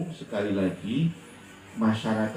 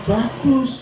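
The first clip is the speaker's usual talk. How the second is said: loud, high-pitched approval; emphatic praise; 11 semitones higher, 6 dB louder than usual. Retold or imitated. Father answers his son's complaint about chores, emphatic approval. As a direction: exclaim it proudly, lifting the pitch sharply.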